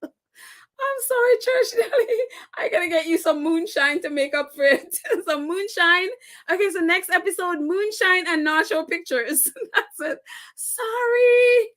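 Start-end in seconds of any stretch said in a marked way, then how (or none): 0.0-2.2 high, slow, helpless with laughter; host apologizing to innocent Church Nelly, laughing hard; laughing apology. Real, not acted. Squeak it out through real laughter. 10.6-11.7 high, stretched-out sorry; host apologizing playfully to the wronged friend, high and drawn out; singsong apology. Real, not acted. Long high call of apology.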